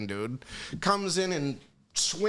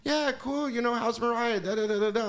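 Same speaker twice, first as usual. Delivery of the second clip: imitating Michael; 7 semitones higher than usual. Michael replies in the story.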